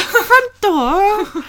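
high-pitched mock British "front door"; British accent. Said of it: "kinda British is when they say door".

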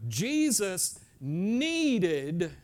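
"needed" drawn out slowly with weight; stress on needed. Real, not acted. Preacher telling congregation to write it down, "needed" drawn out. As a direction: lean long and hard on "needed". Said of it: "I emphasize this word".

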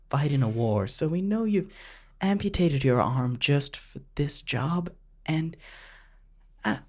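ordinary talk, nothing marked

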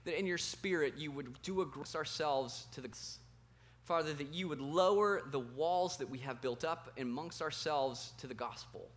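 soft, low, earnest prayer voice. Real, not acted. Pastor praying for the congregation, softly and earnestly. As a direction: pray quietly over the room before the sermon.

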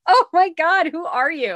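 high, loud mock astonishment; flabbergasted. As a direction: exclaim as if the child is a stranger. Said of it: "And I'm just like".

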